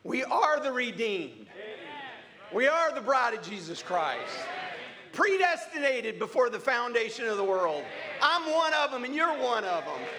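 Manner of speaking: ringing sermon delivery with punched openings and falling line ends; fervent preaching. Real, not acted. Preacher telling the congregation they are the bride, fervent preaching. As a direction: proclaim it to the whole church.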